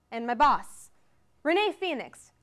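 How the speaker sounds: ordinary talk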